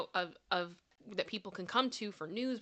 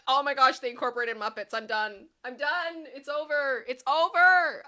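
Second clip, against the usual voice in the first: loud, rising overwhelmed fangirl; overwhelmed excitement; 5 semitones higher, 10 dB louder than usual. Retold or imitated. Telling chat her Muppets reaction, loud and overwhelmed. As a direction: squeal that it's all over now. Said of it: "I'm like".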